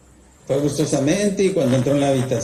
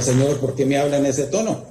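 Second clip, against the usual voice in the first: ordinary delivery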